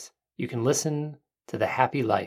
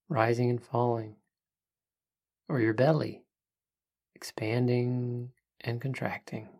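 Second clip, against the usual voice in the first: soft spa whisper; 5 dB quieter than usual. Acted. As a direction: hush it down, calm and unhurried. Leads a guided breathing meditation.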